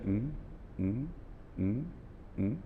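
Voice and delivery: flat "mm" noises, no interest; bored phone murmurs. Shown as a demonstration. Trainer shows a bored phone listener: flat murmuring. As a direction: give only lifeless "mm" sounds down the line. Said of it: "on the phone and I'm just saying".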